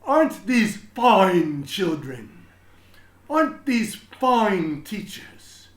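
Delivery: slow, high, loud beaming voice; proud principal. Acted. Principal Mister Keene praising his school, slow proud gushing. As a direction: gush over each child and teacher. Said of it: "he would say".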